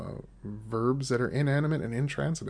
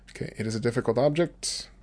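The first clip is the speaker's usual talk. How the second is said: normal talking voice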